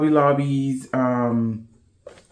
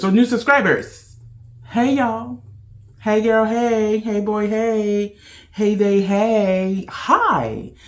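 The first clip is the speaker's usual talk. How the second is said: bubbly welcome, pitched way up; excited greeting; 7 semitones higher, slower than usual. Real, not acted. Vlogger greets her new subscribers, high and bubbly.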